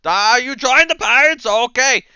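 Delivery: high, loud squawking question; excitable pirate recruiter. Acted. Burly parrot-man hears from a recruit, loud excitement. Crier answers the new recruit.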